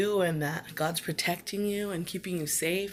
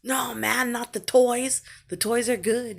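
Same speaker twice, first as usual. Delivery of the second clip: put-on excited little boy protesting; 6 semitones higher, 5 dB louder, slower than usual. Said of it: "you're like".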